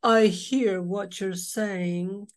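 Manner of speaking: slow sulky fish voice; drawn-out glum reply; gloomy pout fish. Acted. Glum fish answering the clam, slow and sulky. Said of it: "Nice thought, Mister Clam".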